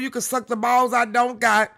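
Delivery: usual speaking voice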